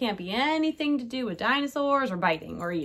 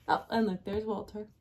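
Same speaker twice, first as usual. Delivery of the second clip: ordinary delivery, nothing marked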